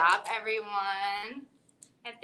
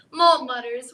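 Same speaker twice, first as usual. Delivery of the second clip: ordinary delivery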